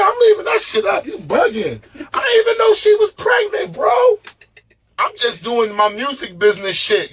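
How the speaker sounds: outraged yell. Retold or imitated. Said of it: "I said".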